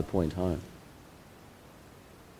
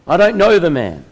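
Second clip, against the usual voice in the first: higher and louder, a sharp denial; forceful denial; 6 semitones higher, 6 dB louder than usual. Acted. Peter denies Jesus to the servant girl, forceful and loud. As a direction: shove the accusation away hard. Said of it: "but more forcefully responds".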